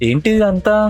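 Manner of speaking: usual speaking voice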